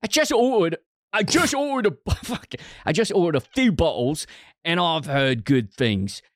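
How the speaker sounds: high-pitched put-on British accent, reading a review. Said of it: "I'm so much better at an English accent than this".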